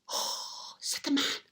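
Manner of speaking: soft unvoiced oh of wonder; whispered oh. Old man whispering after the elves leave, awed. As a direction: breathe it out in awe. Amazed hushed husband.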